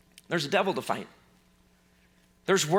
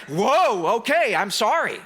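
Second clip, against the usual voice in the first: a sudden louder whoa, then a sheepish sorry; startled apology; 3 semitones higher, 4 dB louder, slower than usual. Retold or imitated. The storm startled by Jesus's voice, apologising. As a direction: back off fast, caught out. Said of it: "And it's like".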